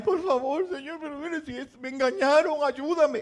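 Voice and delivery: high, hammed-up begging prayer; pleading Jacob. Jacob begging the Lord for help after being cheated, high and pleading.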